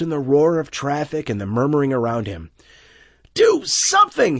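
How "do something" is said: very high, loud scream. A narrator's reading. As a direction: scream for help at the store. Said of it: "he screamed".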